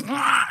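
sharp high startled yelp. Retold or imitated. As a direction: yelp high like a twitchy aunt. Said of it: "she would go like".

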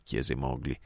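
ordinary delivery

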